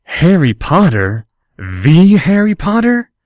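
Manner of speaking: rising, slow star-struck delight; bright enthusiasm. Acted. Harry mimicking a fan to Draco, bright and rising. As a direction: gush at meeting a hero. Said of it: "with bright enthusiasm".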